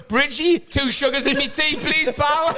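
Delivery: high, laughing mock order to the new boy; cocky senior player calling for his tea, laughing; cheeky laughing order. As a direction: lord it over the rookie, giggling. Teasing the new lad making tea.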